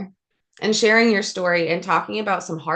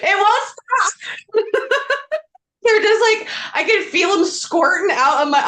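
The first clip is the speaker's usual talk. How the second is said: high, slow, teary laugh-cry; real tears breaking into laughter; 10 semitones higher, 5 dB louder than usual. Real, not acted. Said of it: "I'm really trying not to cry".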